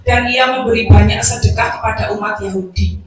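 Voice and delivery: loud, projected Bible reading; scripture reading. Acted. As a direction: read the passage out to the congregation, loudly. Reads the Bible passage aloud. (pembacaan Alkitab dengan suara lantang)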